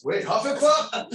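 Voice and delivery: confused surprise. Retold or imitated. Coworker confused by the remark.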